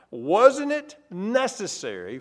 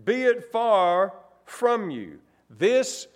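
slow, louder rebuke as Peter; scolding Peter; 3 semitones higher, 3 dB louder, slower than usual. Acted. Peter pulling Jesus aside, slow scolding rebuke. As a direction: scold Jesus like an alarmed friend. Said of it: "He was scolding Jesus and said".

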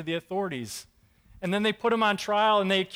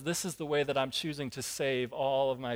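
calm reassurance; 5 semitones lower, 5 dB quieter than usual. Retold or imitated. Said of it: "God says".

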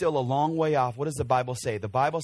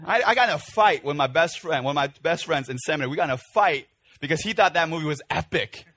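usual speaking voice

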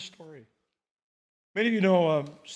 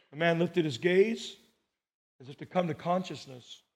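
usual speaking voice

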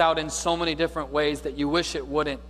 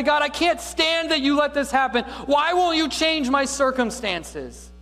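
higher and louder, pleading with God; a desperate cry toward heaven; 11 semitones higher, 4 dB louder than usual. Retold or imitated. Preacher voicing a believer crying out to God, loud plea. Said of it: "You cry out to God".

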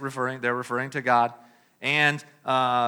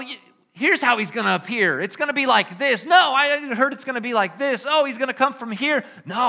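higher, chattering argument; bickering crowd voices; 6 semitones higher, 3 dB louder than usual. Acted. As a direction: play the gossiping crowd going back and forth. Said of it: "they're whispering and they're saying".

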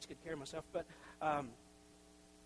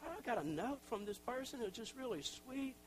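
delighted; 6 semitones higher than usual. Retold or imitated. Telling wife about letter.

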